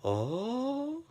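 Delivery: a tiny gasp of surprise; hushed high oh. Retold or imitated. A bar patron reacting to the insult, a hushed surprised oh. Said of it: "one person went".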